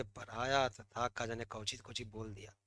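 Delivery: soft, puzzled student grumble; imitating a muddled student. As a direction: mutter it like a student who forgot everything. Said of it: "फिर तुम कहोगे".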